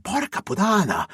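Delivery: sudden high startled oath; shocked curse. Acted. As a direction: blurt it out in sheer shock. Shocked curse at hidden camera.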